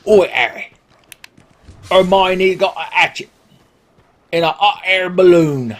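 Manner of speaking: high Cockney character voice dropping H's; Hagrid. Acted. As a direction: exclaim it in broad H-dropping dialect. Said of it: "It's my Hagrid or Agrid impression".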